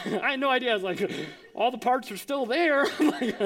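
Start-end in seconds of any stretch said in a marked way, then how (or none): 1.5-2.9 higher, louder pretend-mechanic verdict; hammed-up know-it-all; mock expertise. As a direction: bluff as if you know engines. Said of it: "like I actually knew what was going on".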